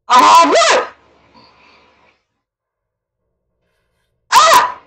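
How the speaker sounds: high loud panicked scream. Real, not acted. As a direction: scream as it lunges. Monster appears.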